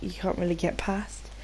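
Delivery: ordinary talk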